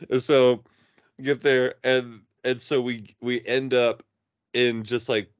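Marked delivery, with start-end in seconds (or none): none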